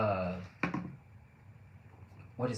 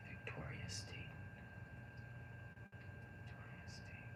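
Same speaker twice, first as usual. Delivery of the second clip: whisper; 14 dB quieter than usual. Real, not acted. Whispering the answer repeatedly.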